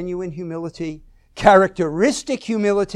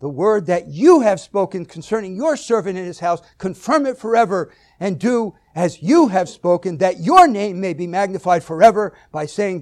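"you" and "your" punched louder; stress on YOU and YOUR; 2 semitones higher, 6 dB louder than usual. Shown as a demonstration. Preacher reading David's prayer to the congregation, "you" and "your" stressed. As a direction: keep landing hard on "you" and "your". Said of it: "put emphasis on certain words".

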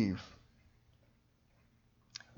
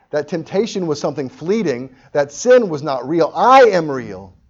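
emphatic declaration; 5 semitones higher, 11 dB louder than usual. Acted. Jesus crashing back into our awareness, forceful declaration. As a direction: press it home, rising to a shout.

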